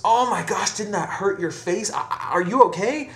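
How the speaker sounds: high-pitched, shocked concern; alarmed priest. Acted. Priest watching a face smash into the bell, alarmed. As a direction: cry out in acted horror, voice jumping up.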